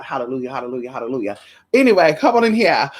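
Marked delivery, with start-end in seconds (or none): 0.0-1.4 low, quiet praise; soft hallelujahs. Real, not acted. Praising God softly in gratitude. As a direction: breathe out the hallelujahs.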